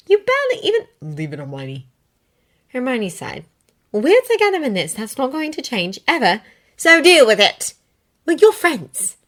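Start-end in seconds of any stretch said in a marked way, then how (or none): 0.1-0.9 Hermione voice. Acted. Hermione high. 1.0-1.9 quiet curt dismissal. Acted. Harry shuts her down. 3.9-9.2 Hermione voice. Acted. Hermione firm, high.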